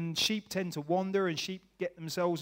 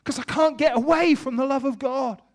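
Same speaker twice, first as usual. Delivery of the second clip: loud and high, overwhelmed; high, loud, emotional outburst; loud declaration; 7 semitones higher, 8 dB louder than usual. Retold or imitated. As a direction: proclaim it to your wife. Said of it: "I turned to Claire, I said".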